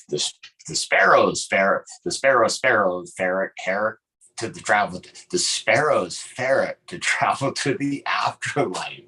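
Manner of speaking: drawling Appalachian hillbilly accent. Shown as a demonstration. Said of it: "I should say it like a hillbilly".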